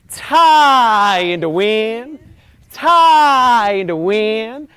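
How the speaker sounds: Tie in the wind, loud and very slow, rising on Tie; slow loud intonation. Shown as a demonstration. Coach leading warm-up, loud slow chant. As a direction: let the words sway like a tree. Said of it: "do the whole thing together".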